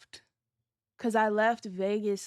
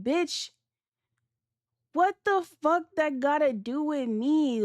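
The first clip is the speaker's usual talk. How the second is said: higher-pitched outraged retort; outraged comeback; 6 semitones higher, 3 dB louder, slower than usual. Retold or imitated. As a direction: fire it back like she's lost her mind. Angry retort to friend.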